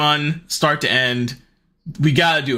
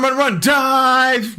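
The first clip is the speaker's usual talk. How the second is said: high, stretched-out DIVE; mock action commentary, pitched up; acted-out dive; 10 semitones higher, much slower than usual. Acting out daughter's bed dive.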